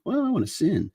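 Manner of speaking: high-pitched mocking quote; light, high caricature of a defiant human; mock sinner voice. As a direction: put on a silly high voice for the rebel. Acted quote of sinful humans.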